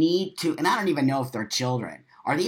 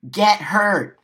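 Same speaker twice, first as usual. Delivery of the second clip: "Get" hit hard and loud, pitch higher; louder repeat; 5 semitones higher, 5 dB louder than usual. Shown as a demonstration. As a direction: shout the second "Get hurt" at them.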